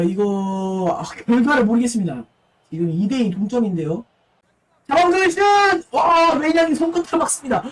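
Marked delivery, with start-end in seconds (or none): 4.9-7.6 esports caster. Acted. Shot and save call.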